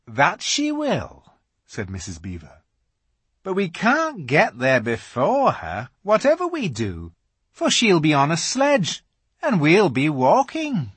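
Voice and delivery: high beaver-wife voice; steady Missus Beaver; calm Missus Beaver. A narrator's reading. Missus Beaver explains calmly.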